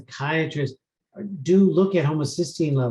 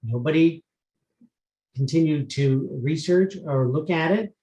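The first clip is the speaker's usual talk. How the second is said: normal talking voice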